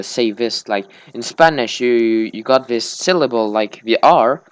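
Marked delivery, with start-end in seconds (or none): none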